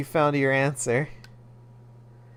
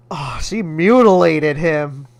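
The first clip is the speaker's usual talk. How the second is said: normal talking voice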